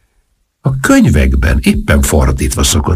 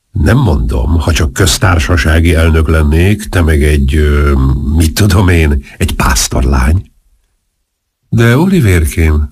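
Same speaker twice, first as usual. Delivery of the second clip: plain reading voice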